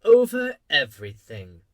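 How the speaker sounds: loud, grand royal statement with falling tune; king answering. A narrator's reading. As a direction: declare it loudly as absolute monarch. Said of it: "the king answered".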